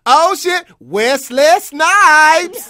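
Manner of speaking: high loud slow yell of the name; loud slow fan shout; drawn-out shout. Retold or imitated. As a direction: stretch out his name in a yell. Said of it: "and then his full name".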